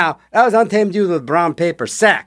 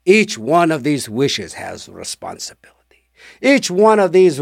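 eerie genie whisper. Retold or imitated. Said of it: "he said".

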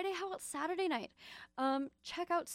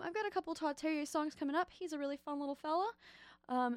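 normal talking voice

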